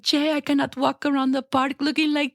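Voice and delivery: put-on high Colombian accent, gushing. Gloria on Modern Family gushing to Jay in Colombian accent. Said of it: "in her Colombian accent".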